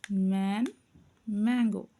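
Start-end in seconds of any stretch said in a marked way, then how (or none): none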